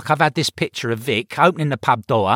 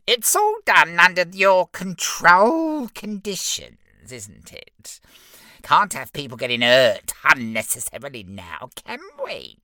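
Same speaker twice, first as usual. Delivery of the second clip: very high smug little imp; giggly sneering imp; 7 semitones higher than usual. Acted. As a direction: squeak it out smugly. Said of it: "the lead imp explained".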